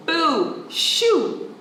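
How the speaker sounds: shooing. Acted. Child shooing the jay, high. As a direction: shoo the jay away.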